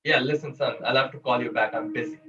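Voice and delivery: subtle indifference. Shown as a demonstration. Workshop leader repeating the father brushing off his son. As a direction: sound too busy to care. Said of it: "See that? That's a subtle indifference".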